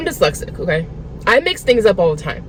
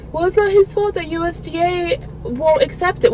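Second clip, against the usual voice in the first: high-pitched, whiny mimicry of an excuse; mocking imitation; 8 semitones higher than usual. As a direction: parrot their excuse in a put-on high voice. Mocks what others keep telling her.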